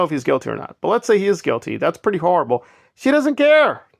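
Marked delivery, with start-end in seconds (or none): 3.0-3.8 she doesn't care, said high and louder; indignant outburst. Real, not acted. Vlogger to viewers, indignant and pitched up. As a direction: let the outrage jump up in pitch. Angry point about Nora.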